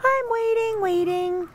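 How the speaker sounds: lightly voiced begging dog; whiny dog plea. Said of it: "She says".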